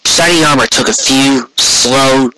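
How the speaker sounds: plain reading voice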